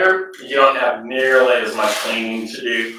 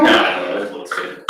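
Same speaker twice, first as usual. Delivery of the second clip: whisper; 14 semitones higher, slower than usual. Real, not acted. Unnamed whisper while on camera.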